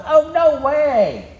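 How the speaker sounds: high, drawn-out "no way"; emphatic protest. Acted. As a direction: protest it high and slow, in disbelief. Peter protests at predicted denial.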